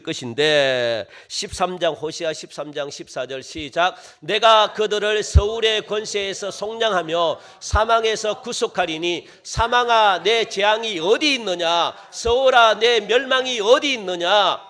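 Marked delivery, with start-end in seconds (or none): 4.2-14.6 slow, declaimed verse, building louder; full-force scripture reading. Real, not acted. Preacher leading the congregation through Hosea, full-force scripture reading. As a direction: proclaim each phrase with drawn-out endings.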